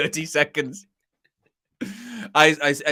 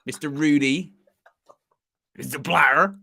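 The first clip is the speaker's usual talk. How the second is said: put-on light Bristolian accent through laughter; a hint of Bristolian, laughing; Bristolian Ian Holloway. Pundit imitating Holloway, laughing, light Bristolian accent. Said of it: "That's the one accent I can do".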